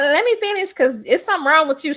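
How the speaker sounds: high, odd assistant voice; funny assistant voice. Retold or imitated. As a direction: mimic her funny voice. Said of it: "she talked real funny".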